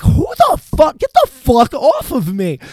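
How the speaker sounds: high, retold outburst of alarm and anger; indignant shout. Teen to plainclothes guards grabbing him, indignant yell. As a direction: act out yelling at someone grabbing you. Retelling being grabbed by strangers.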